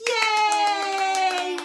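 loud, high yay; cheer. Real, not acted. Teacher cheering children after the song. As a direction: cheer them on.

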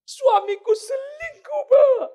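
very high, slow, loud wail; wailing wife. Acted. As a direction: cry out the betrayal. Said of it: "Satu orang telepon saya". (istri meratap sangat tinggi dan keras)

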